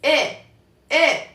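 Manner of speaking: E fading into a slight U at the end; E ending in faint U. Shown as a demonstration. Teacher showing the faint U ending of Mandarin E. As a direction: let the E glide barely toward U. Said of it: "se escucha un poquitito como una U".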